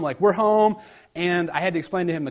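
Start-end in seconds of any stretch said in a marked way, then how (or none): 0.2-0.8 louder, higher mock-excited child; brief burst of a kid's glee, lightly acted; imitating excited son. Dad quoting his son eager to see Mom, excited. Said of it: "he's excited to see Mom".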